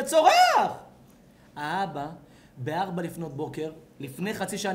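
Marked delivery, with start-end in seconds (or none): 0.0-0.7 high, loud outburst; exclaimed shout. Retold or imitated. Preacher telling how baby resumes screaming, exclaimed shout.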